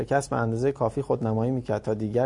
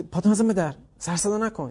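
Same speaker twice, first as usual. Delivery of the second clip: 1 scolding mother; 7 semitones higher than usual. Retold or imitated.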